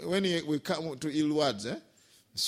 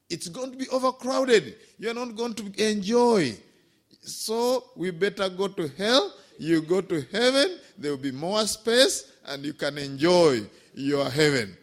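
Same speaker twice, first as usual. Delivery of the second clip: louder, laughing punchline delivery; playful comeback; 4 semitones higher, 7 dB louder than usual. Retold or imitated. Monk teasing the villager, louder and laughing. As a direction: win the argument with a grin.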